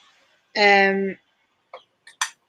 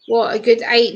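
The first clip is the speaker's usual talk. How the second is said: ordinary talk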